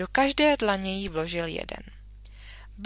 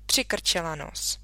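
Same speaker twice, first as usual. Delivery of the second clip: usual speaking voice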